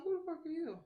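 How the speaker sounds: high, loud startled yell from the top bunk. Retold or imitated. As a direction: jolt awake and bark it high. Sleeping cellmate woken, startled.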